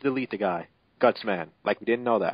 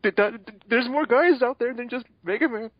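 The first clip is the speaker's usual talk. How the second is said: high, put-on girlish comforting voice; comforting friend voice; 12 semitones higher than usual. Mail consoles heartbroken Roll in a high put-on voice.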